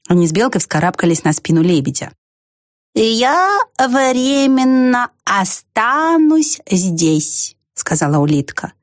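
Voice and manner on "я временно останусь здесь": ponderous, drawn-out snail voice; unhurried, pondering creature; slow snail. A narrator's reading. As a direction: draw out each word lazily. Same slow snail character.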